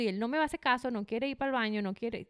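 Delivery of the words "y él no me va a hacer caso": ordinary talk, nothing marked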